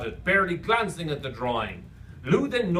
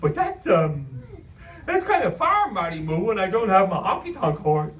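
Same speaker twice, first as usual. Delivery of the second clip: loud, high cartoon clown, thrown off; hesitant goofy imaginary friend; 4 semitones higher, 4 dB louder than usual. Imaginary friend balks at a far errand, goofy hesitation. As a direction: object in a silly, hesitant voice.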